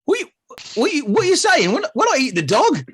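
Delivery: high, loud outraged disbelief; shocked guard. Acted. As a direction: squeak out horrified protest. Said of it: "And this guy's like".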